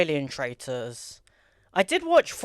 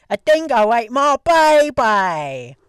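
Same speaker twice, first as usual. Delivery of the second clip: slow, louder hammed-up Australian accent; mock Australian accent; 6 dB louder, slower than usual. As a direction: drag it out like a cartoon Aussie. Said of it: "That's more Australian".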